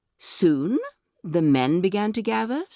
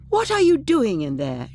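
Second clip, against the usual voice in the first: raised, high-pitched question falling away; asking aloud; 6 semitones higher, 5 dB louder than usual. A narrator's reading. Miss Harper questions whatever is rustling in the closet, asking aloud. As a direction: call out the question, high and louder. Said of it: "she asked aloud".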